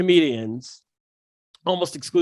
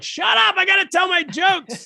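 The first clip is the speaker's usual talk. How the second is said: much higher shouted retort; mock yell; 13 semitones higher than usual. Comedian yelling back at a rowdy bar audience, mock shout. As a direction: bark it back at a rowdy crowd. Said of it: "We're like".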